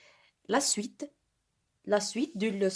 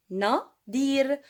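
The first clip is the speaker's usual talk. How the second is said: "Nadir" split as Na, dir, louder on each part; syllable-by-syllable dictation; 4 semitones higher, 4 dB louder than usual. Shown as a demonstration. Teacher sounding out a name for pupils, split syllables. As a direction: say "Nadir" with a clear gap after Na.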